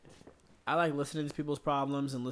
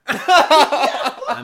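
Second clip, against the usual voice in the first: laughing; 14 semitones higher, 18 dB louder than usual. Real, not acted. Laughing joke.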